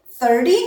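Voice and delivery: "thirty" as thur-dee, first syllable stressed; flapped t. Shown as a demonstration. Teacher comparing similar words, "thirty" with a flapped t. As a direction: tap the t lightly in "thirty". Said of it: "changes into the flap".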